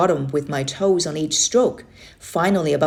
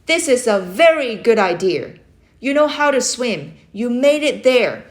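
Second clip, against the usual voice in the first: loud, high firm encouragement building to the go; firm countdown; 6 semitones higher, 4 dB louder than usual. Acted. Uncle counts the boy off to swim back, firm countdown. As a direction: count him off like a coach.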